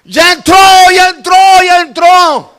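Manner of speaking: retold cry of breakthrough; excited shout. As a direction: shout it out in triumph. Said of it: "Y le grité cuando miré".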